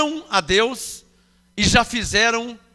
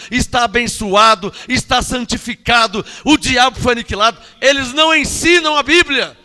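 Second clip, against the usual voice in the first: fervent preaching; 5 dB louder than usual. Acted. Preacher proclaims the gospel loudly.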